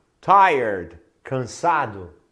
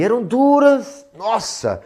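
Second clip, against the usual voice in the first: emphatic; 10 semitones higher, 4 dB louder than usual. Real, not acted. Candy hard as glass.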